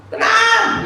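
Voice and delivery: high, forceful shout; sudden high yelled order; loud command. Shown as a demonstration. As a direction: bellow it over a roaring storm. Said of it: "Yesus ngomongnya kencang nggak?".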